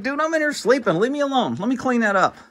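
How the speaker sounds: the cat whining to be left alone; voicing the cat. Cat telling his owner to leave him alone, high grumpy voice. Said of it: "He's like".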